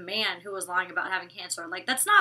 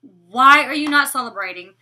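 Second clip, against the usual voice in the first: loud exasperated question; 3 semitones higher, 12 dB louder than usual. Real, not acted. Scolding Olivia.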